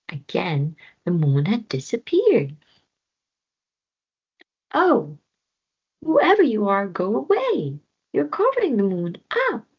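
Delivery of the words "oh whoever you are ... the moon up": crying out. A narrator's reading. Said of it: "cried Floki".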